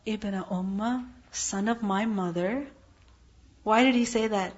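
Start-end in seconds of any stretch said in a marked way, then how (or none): 0.1-2.7 softer, calm pleading; very calmly. Retold or imitated. Harun calming his furious brother, softly and calmly. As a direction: soothe an angry brother gently. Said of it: "very calmly".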